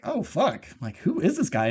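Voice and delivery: alarmed. Retold or imitated. Acts own reaction to the killings.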